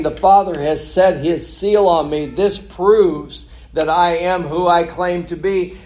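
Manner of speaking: high, loud, slow and emphatic; forceful preaching. Acted. Jesus asserting the Father's seal to doubters, forceful preaching. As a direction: drive each phrase home from the pulpit.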